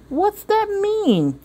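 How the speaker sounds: high, slow innocent question; puzzled child. Acted. Sophia asking mother, high and slowly puzzled. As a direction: ask it slowly like a confused little girl. Sophia asks about the word.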